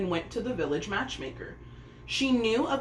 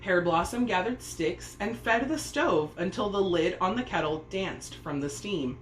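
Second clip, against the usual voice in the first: ordinary delivery, nothing marked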